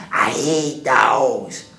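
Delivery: slow, loud growled complaint; monster snarl. Acted. Hairy man seeing the dogs, slow loud snarl. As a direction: drag it out in disgust.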